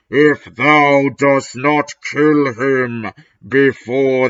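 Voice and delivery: slow menacing dark lord; evil raspy voice. Acted. Darth Vader threatens Ebony, slow and evil. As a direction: drag it out slowly, threatening. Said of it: "said evilly in his raspy voice".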